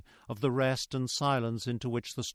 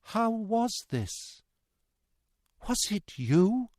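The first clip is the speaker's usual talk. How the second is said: slow, higher-pitched halting question; slowly; 8 semitones higher, slower than usual. A narrator's reading. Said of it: "though slowly".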